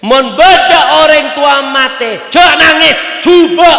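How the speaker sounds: high, loud, slow shout. Real, not acted. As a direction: drive it home at full voice. Preacher shouting his point. (berteriak lantang)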